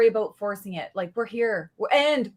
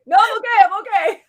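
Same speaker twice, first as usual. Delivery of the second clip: high, loud, laughing 'I'm okay'; brushing off the worried instructors with a laugh; laughing reassurance; 8 semitones higher, 8 dB louder, faster than usual. Retold or imitated. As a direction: insist you're fine through a laugh. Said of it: "I'm like".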